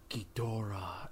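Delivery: low, soft, lingering imitation of the dubbed line; hushed, ominous drawn-out name; English dub imitation. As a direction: let the name hang in the air. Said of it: "the way it's said in English".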